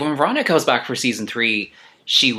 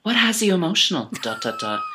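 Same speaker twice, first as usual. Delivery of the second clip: [name] impression; 12 semitones higher than usual. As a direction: coax the tears out like a TV host. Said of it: "Ru would go".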